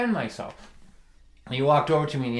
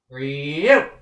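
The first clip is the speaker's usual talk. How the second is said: "for you" punched loud and pointed; sarcastic stress; 7 dB louder than usual. Shown as a demonstration. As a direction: lean hard on "for you" with a sneer. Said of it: "Like that".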